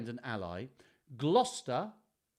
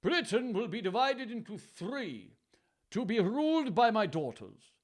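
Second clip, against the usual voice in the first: aged royal king; 8 semitones higher, 4 dB louder than usual. Acted. Voices King Lear in the story.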